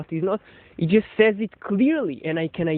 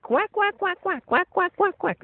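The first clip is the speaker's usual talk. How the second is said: high, playful quacking noises; a mother quacking to her baby; mothers' baby-talk quacking; 10 semitones higher than usual. Retold or imitated. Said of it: "like I'm going now".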